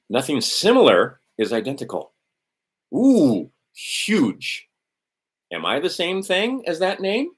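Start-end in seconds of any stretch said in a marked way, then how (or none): 2.9-4.7 high, drawn-out ooh of amazement; impressed ooh. Real, not acted. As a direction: sound genuinely wowed by it. Reacting to a maxim.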